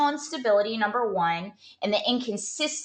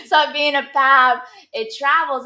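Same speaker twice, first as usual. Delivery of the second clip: louder, higher mock read; mock reading aloud; 5 semitones higher, 6 dB louder than usual. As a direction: read the note out dramatically. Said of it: "friend reads note".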